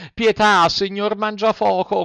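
high, begging cry for mercy; pleading. A narrator's reading. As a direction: beg at his feet. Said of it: "con voce supplichevole".

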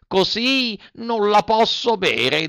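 put-on high, drawn-out childish grumble; whining Pinocchio. A narrator's reading. Puppet grimacing at the glass, whining. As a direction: drag out the complaint in a high voice. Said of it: "facendo mille smorfie".

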